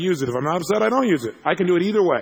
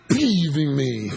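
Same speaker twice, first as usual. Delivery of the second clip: deflated anticlimax; much slower than usual. Pretend. Imagining a pattern break, comically mild. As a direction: suddenly drop to normal pitch, drawn out slowly.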